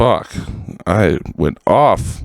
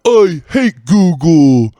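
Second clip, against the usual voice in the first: much higher, louder whining caricature; whiny mock complaint; 8 semitones higher, 4 dB louder, slower than usual. Podcaster mocks Californians who complain instead of leaving, loud whiny voice. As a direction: whine like a spoiled local who missed out. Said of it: "whining and going".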